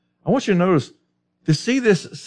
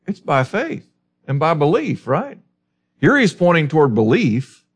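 ordinary talk

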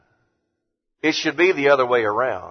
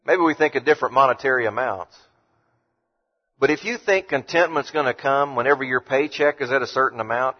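normal talking voice

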